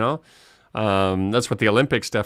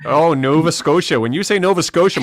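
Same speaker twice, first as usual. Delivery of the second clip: put-on Canadian accent; 6 semitones higher, 6 dB louder than usual. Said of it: "my Canadian accent's gonna come out, eh?".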